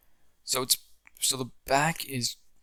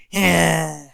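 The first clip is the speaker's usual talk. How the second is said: gloating villain yeah; 6 semitones higher, 9 dB louder than usual. Retold or imitated. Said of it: "Jeremy just goes".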